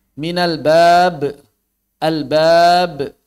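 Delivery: bab said slowly and loud with the final b released as a small echo; slow Minal bab, popping the last b; bouncing final b. Shown as a demonstration. Teacher showing students the released final b, slow and loud.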